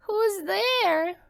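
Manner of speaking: high, frail granny; a frail old lady calling through the door; old grandmother voice. A narrator's reading. Grandmother answering the knock, high and frail. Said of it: "asked the grandmother".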